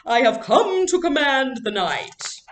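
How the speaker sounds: slow, high and loud grand proclamation; grand theatrical queen voice; dramatic queen. Acted. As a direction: intone it like a stage queen commanding her court. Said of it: "she intoned dramatically".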